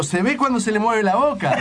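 high-pitched imitation of a heckler; mock heckling yell. Host retells a spectator heckling the ventriloquist, mock yell. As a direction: call it out like an outraged spectator, half-laughing. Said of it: "otro gritó".

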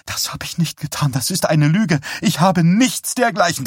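high-pitched distressed protest; frantic denial. A narrator's reading. Wife denying she acted behind husband's back, frantic protest. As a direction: protest shrilly, rising in pitch.

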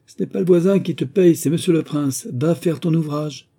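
high, loud telling-off; angry scolding. A narrator's reading. Elder sister ordering gardener back to work, loudly scolding. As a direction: scold hard, slowing on the final order. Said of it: "gronda la personne anguleuse".

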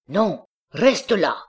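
father cries out to stop his daughter leaving; cry out. A narrator's reading. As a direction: stop her at the door with a cry. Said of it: "s'écria-t-il".

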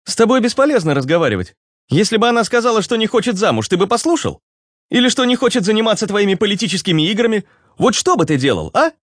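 high, challenging character voice; Руслан. Acted. (высокий, спорящий голос персонажа)

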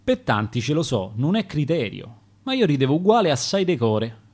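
ordinary delivery, nothing marked

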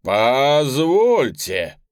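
slow, loud, drawn-out objection; loud interjection. Acted. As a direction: cut in loudly, drawing it out. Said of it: "вмешался министр".

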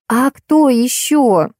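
slowly, as if it's obvious and astonishing; stretched incredulous question; wide-eyed surprise. A narrator's reading. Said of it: "вытаращилась Алиса".

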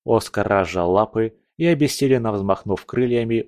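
ordinary delivery, nothing marked

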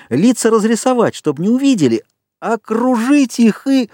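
high, mounting eagerness; keyed-up high voice, drawing out the last words; excited plotting. A narrator's reading. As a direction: get carried away with the plan. Jack excitedly plans the hunt.

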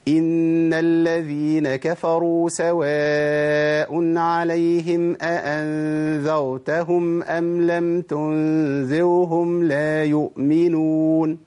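drawn-out recitation, "تنذرهم" with a weak ر lacking its tongue-tip contact; third ر mistake. Shown as a demonstration. Teacher demonstrating the third mistake, slow chant with faulty ر. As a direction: chant slowly, slackening the ر in "أأنذرتهم" and "تنذرهم". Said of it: "اسمع الخطأ الثالث بقى".

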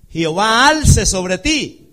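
loud, slow proclamation; preaching at full force. Acted. Preacher reading the blessing to church, loud slow. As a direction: stretch and ring out each word.

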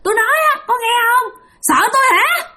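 very high-pitched retold holler; taunting yell. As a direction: yell a challenge after someone to provoke them. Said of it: "cho nên ổng mới la làng lên". (la làng khích bác, giọng cao vút)

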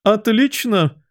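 very high exclamation; gleeful yell. A narrator's reading. Queen answering her soldiers, yelling. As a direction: cry out imperious approval. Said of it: "завопила королева".

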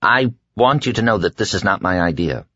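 ordinary delivery, nothing marked

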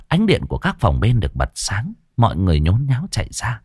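plain reading voice

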